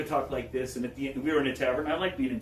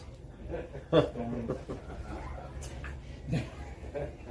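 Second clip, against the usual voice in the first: laugh; 3 semitones lower, 8 dB quieter than usual. Real, not acted. Laughing at the prayer.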